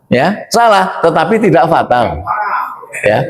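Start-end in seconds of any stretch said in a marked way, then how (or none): none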